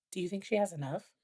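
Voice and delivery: softly voiced doubt; quietly quoted question. Quoting their private pre-Christmas question.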